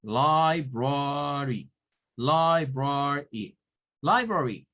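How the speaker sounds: library said slowly as LIE-bra-ree; teacher models library syllable by syllable; model pronunciation. Shown as a demonstration. Teacher modelling library for the class, slow and clear. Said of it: "Porque es library".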